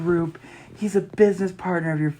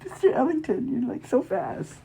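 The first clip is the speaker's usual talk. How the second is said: put-on swooning girl; 8 semitones higher, 4 dB quieter than usual. Swooning at rescuer.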